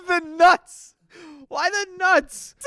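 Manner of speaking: high, loud disbelieving question; can't believe what he read; incredulous. Real, not acted. Reacting in disbelief to the nuts text, high and loud.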